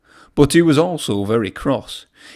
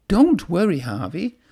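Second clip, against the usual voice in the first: higher, gentle comfort; reassuring; 7 semitones higher than usual. A narrator's reading. Thomas comforting lonely Harvey, gently and higher. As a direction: soothe a sad friend. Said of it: "he said".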